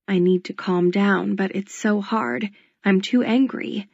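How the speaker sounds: usual speaking voice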